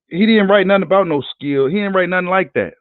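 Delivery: normal talking voice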